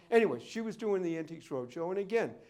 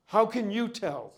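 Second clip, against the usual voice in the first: indignant challenge; 4 semitones higher, 7 dB louder than usual. Retold or imitated. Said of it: "the lady in this tone sort of goes".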